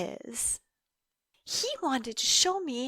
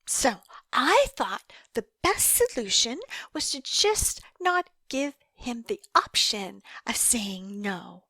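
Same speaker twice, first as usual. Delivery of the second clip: normal talking voice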